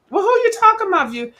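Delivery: high fast sassy retort; indignant. Pretend. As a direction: snap back, high and quick.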